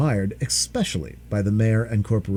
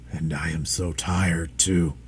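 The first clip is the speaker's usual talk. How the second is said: low, slow, softer dragon; tired dragon; 7 semitones lower, 5 dB quieter, slower than usual. Acted. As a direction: sigh it out, exhausted. Dragon exhausted.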